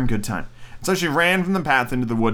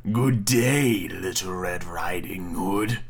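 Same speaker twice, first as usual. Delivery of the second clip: wolf voice; 6 semitones lower, 4 dB quieter, much slower than usual. Acted. Wolf greets the girl.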